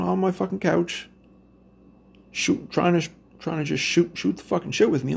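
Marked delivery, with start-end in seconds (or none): none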